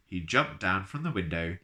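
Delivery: ordinary delivery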